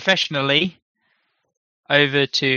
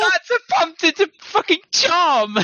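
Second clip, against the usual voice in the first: excited outburst. Real, not acted. Player's gasping outburst at table.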